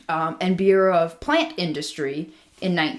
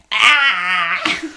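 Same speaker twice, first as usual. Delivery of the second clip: frustrated yelp; 3 semitones higher, 6 dB louder than usual. Retold or imitated. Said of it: "and I'm like".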